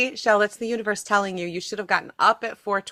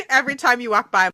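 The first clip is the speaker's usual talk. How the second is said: normal talking voice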